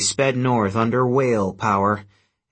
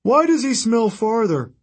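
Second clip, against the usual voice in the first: much higher, whining Cyclops; moaning Cyclops; 12 semitones higher, 3 dB louder than usual.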